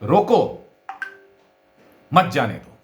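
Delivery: "रोको" alone and punched, long pause, then "मत जाने दो"; pause after रोको. Shown as a demonstration. Teacher demonstrates an order to stop someone, pause after "रोको". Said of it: "केवल स्वराघात से ही तो हमारी बात को कहते हैं".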